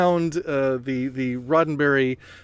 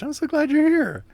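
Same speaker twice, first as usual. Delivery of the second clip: high, gushing welcome; bubbly, delighted greeting; excited; 12 semitones higher than usual. Real, not acted. Host gushes at guest.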